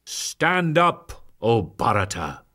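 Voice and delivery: plain reading voice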